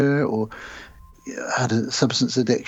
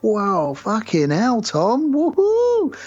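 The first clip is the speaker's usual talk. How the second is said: high-pitched whooping, climbing to the last word; mock party cheer; 11 semitones higher, much slower than usual. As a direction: imitate an amazed party crowd cheering. Party guests cheering, acted out.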